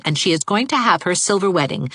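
ordinary delivery, nothing marked

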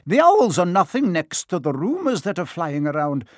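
prim Professor McGonagall. Acted. McGonagall presses.

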